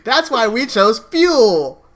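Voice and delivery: loud, high, drawn-out slogan; big slow joking yell; mock triumphant shout. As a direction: holler it like a punchline catchphrase. Shouted joke slogan after laughing.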